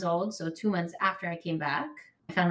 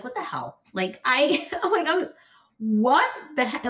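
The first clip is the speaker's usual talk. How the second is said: rising, louder exasperated outburst; retold angry outburst; 6 semitones higher, 5 dB louder than usual. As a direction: blurt out the disbelief, voice climbing.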